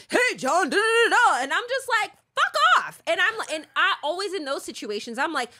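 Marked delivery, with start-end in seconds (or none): 0.1-1.4 higher and louder pushy call; mock paparazzo yelling. Imitating TMZ hounding John, loud mock yell. 2.4-3.0 much higher, louder snapping fuck off; retold yell. Recalling telling the TMZ guy off, loud yell.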